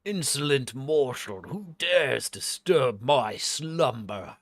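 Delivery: loud, slow, booming indignation; wrathful spirit. Acted. Spirit challenging whoever woke it, loud wrathful proclamation. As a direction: thunder it slowly like a spirit roused from sleep. Voicing an angered spirit in game.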